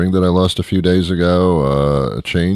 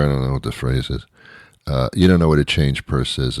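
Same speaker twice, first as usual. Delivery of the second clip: ordinary talk, nothing marked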